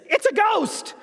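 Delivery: high-pitched mock cry of fear; acted fright. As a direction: play a disciple spooked by a ghost. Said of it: "very scared, very afraid moment".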